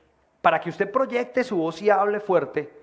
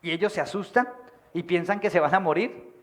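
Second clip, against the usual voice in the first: ordinary talk, nothing marked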